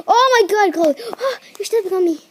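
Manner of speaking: high, loud outburst speeding up; exclaiming in protest. Real, not acted. Protesting as Chloe steps on the speaker, high exclamation. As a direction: blurt it high, then rush the complaint.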